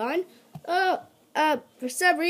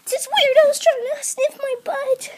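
squeaky panicked voice; 4 dB louder than usual. Acted. Panicked character calls for help.

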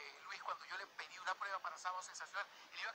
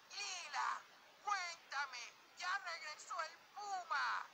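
TV host; 11 semitones higher, much slower than usual. Retold or imitated. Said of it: "Le decía".

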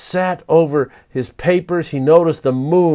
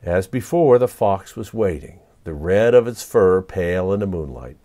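usual speaking voice

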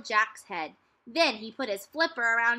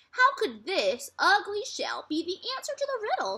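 put-on puzzled girl; 9 semitones higher than usual. A narrator's reading.

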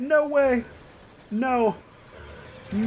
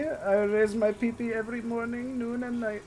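silly voice; 5 semitones higher, 6 dB quieter than usual. Pretend. Silly put-on voice, mocking.

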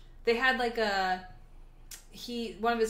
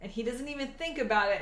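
normal talking voice

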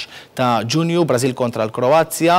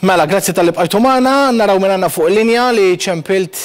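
bright, louder host welcome, pitched up; lively on-air greeting; 7 semitones higher, 5 dB louder than usual. Real, not acted. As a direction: greet the callers with energy. Host greets phone-in callers on air.

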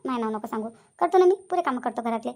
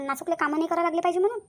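normal talking voice

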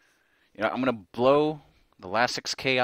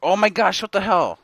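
loud, higher exasperated shout; frustrated yell; 10 semitones higher, 14 dB louder than usual. Real, not acted. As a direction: yell at the stubborn game. Frustrated at game.

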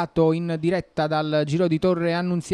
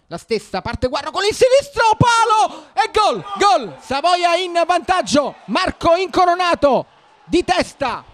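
screaming GOL, high and loud; goal shout; 8 semitones higher, 4 dB louder than usual. Real, not acted. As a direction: erupt as the ball goes in. Goal scored, commentator erupts. (urlo da gol)

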